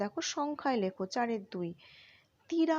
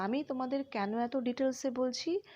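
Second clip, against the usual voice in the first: ordinary talk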